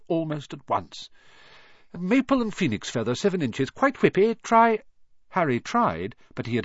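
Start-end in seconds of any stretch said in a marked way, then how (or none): 2.0-4.8 high, loud, excitable wandmaker; keen, lifted recital ending in a command; eager urging. A narrator's reading. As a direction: press the next wand on the customer. Wandmaker eagerly hands over next wand.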